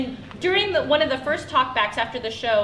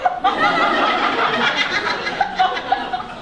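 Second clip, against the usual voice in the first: high, loud long laugh; long real laugh; 14 semitones higher, 4 dB louder than usual. Real, not acted. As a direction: crack up at the story. Laughs at DVD joke.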